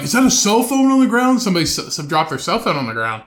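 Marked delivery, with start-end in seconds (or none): 0.0-1.4 mock protester. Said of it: "they're like".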